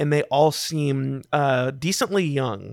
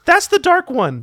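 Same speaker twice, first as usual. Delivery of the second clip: high-pitched, louder goblin voice; little goblin naming his friend, pitched way up; goblin introduction; 5 dB louder than usual. Acted. Goblin points out the drow, high and loud. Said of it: "and he says".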